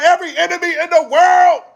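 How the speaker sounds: high, loud alarm; warning Craig in a loud high voice; mock paranoid shout. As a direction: yell out the threats around you. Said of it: "I'm like".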